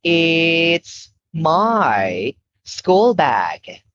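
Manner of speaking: very slow, clear model sentence. Shown as a demonstration. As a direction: stretch each word out for the child. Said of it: "Now you say".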